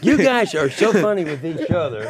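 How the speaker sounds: high, loud helpless laughter through the words; breaking into big loud laughs; laughing hard. Real, not acted. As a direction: crack up while talking. Cracked up by the hosts.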